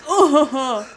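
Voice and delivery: ordinary talk, nothing marked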